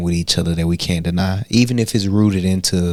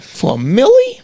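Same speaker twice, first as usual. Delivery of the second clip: high, loud incredulous quote; 5 dB louder than usual. Retold or imitated. Retelling an army buddy's eager answer, loud and high. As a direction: shout it up high, amazed.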